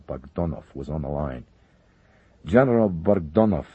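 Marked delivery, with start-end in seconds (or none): 2.4-3.7 firm, raised command voice; commanding order. Acted. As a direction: bark the launch order. Minister orders nuclear strike.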